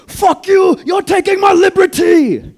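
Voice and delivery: very high, full-volume screaming outburst; freaked-out scream. Retold or imitated. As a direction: scream it wildly, stretching out the words. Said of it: "The Vietnamese guy's freaking out".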